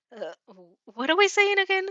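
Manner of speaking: embarrassed, fumbling question; flustered. Acted. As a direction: trip over the words, blushing. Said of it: "he stammers".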